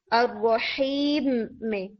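अर्रही slow with audible air on the ह; audible breathy ha. Shown as a demonstration. Teacher showing whispered quality of ha. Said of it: "आप इस हम्स को सुन सकते हैं".

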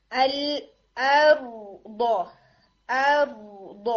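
ارض drawn out slowly, the alif kept light and the r heavy; light alif then heavy r. Shown as a demonstration. Teacher models ارض for students. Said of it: "جب آپ word بول رہے ہیں".

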